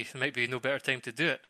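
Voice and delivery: normal talking voice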